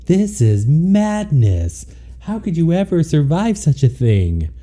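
dramatic disbelief. Retold or imitated. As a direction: ham it up like a tragic hero. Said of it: "And I was like".

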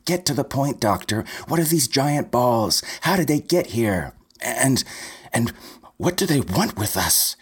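high, pushy demands fired at the doctor; impatient interruption. A narrator's reading. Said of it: "Linda Stevenson butted in".

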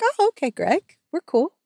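ordinary talk, nothing marked

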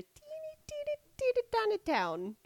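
high squeaky cutesy voice. Acted. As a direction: make it sound tiny. Cutesy repetition.

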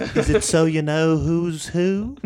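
put-on naive British accent, amused; mock-earnest British question; Jon Ronson. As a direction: wonder aloud as a baffled British interviewer. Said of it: "Jon Ronson talks exactly like a guy".

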